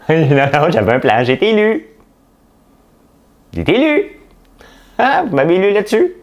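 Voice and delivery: high, loud indignant parody voice; hammed-up politician protesting; mock mayor. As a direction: whine that you were elected on it. Impersonating mayor defending her plan.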